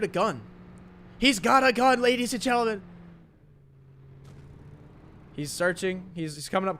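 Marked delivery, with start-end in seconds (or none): 1.2-2.8 higher, louder, caster-style call; excited announcing. Real, not acted. Caster announcing to viewers, excited and loud. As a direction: hype up the reveal.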